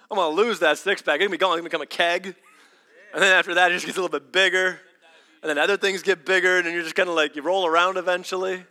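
loud, joking preacher riff; preaching. Acted. Pastor joking to the congregation about bellies, loud. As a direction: play the belly joke big.

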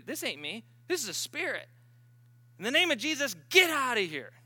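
rising rebuke. Retold or imitated. Sister rebuking the spirit.

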